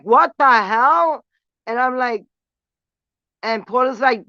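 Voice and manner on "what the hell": high, loud and slow; drawn-out stunned echo; shocked. Retold or imitated. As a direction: gasp it out in disbelief. Narrator echoes her shock.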